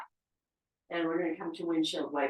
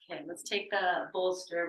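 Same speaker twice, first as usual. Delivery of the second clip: usual speaking voice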